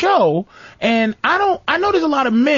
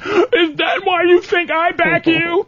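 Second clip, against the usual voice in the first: mock hurt girlfriend; 7 semitones higher, slower than usual.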